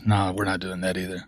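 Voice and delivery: ordinary delivery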